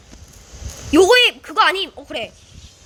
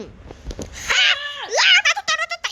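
high wailing cry; pained cry; 9 semitones higher, 3 dB quieter, much slower than usual. Real, not acted. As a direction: wail as you fall. Falls in game, cries out.

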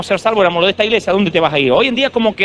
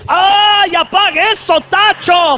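put-on high, loud foreign-accented yell; gruff old pastor shouting at the drummer; mock German pastor's shout; 12 semitones higher, 5 dB louder, much slower than usual. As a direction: bark it like the pastor, much higher. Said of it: "Le decía".

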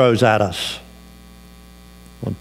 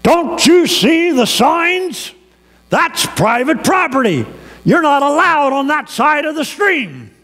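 angry yell; 12 semitones higher than usual. Retold or imitated. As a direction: bark it like an irate landowner. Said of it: "yells at me".

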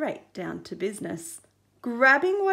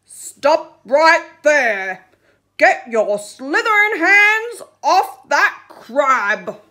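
commanding shout; 4 semitones higher, 13 dB louder, slower than usual. Acted. Shark stops the octopus.

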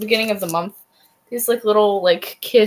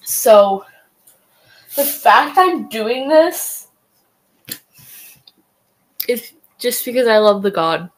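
talking around a wad of gum; words slightly mushed by gum; chewing gum; 4 dB louder than usual. Real, not acted. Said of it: "I have gum in my mouth".

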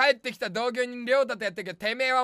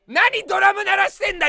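higher, louder comic snap; mock scolding; 8 semitones higher, 8 dB louder than usual. Comedian scolding whoever played the drum, mock-angry yell. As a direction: snap at the noise in mock anger.

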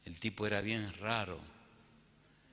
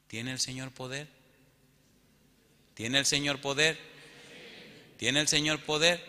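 building insistence; 4 semitones higher, 4 dB louder than usual. Real, not acted. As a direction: press harder with each asking. Preacher repeats question, building to climax.

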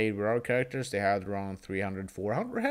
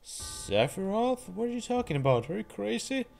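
much higher, incredulous hero voice; alarmed young hero; 9 semitones higher, 3 dB quieter than usual. Acted. Hero confronting Sephiroth, high and incredulous. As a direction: push back in disbelief.

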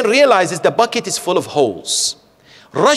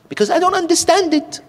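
put-on higher childlike voice, pitch lifted; child's voice; faster than usual. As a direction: voice the little boy who sees no point. Child's quote in grandfather story.

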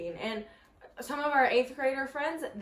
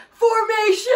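loud, high battle cry; hammed-up yell; imitated shouted command; 9 semitones higher, 11 dB louder, slower than usual. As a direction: holler it like a rallying order. Imitating Mason shouting the order.

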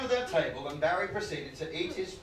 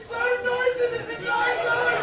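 laughing; 7 dB louder than usual. Real, not acted. Laughing outburst at audience member.

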